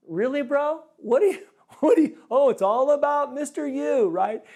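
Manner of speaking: high, loud sarcastic jeer with a laugh; mock the line-cutter as the crowd would. People in line mocking the pastor, high sarcastic jeer. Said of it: "with a look on their face like".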